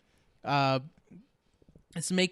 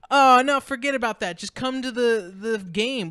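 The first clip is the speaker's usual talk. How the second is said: higher, louder teasing friends brushing it off; mock pushy friends; 6 semitones higher, 7 dB louder than usual. Friend mimics buddies urging Andy to the game. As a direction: wave off the science fair, higher.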